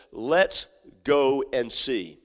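let's... go... and... see, savored; slow, drawn-out. Acted. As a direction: stretch each word slowly. Preacher repeats shepherds' words.

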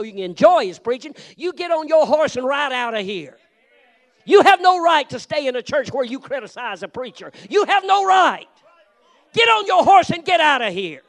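fiery scolding at full volume; angry pulpit shout. Real, not acted. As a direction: drive them out with your voice. Preacher rebuking critics.

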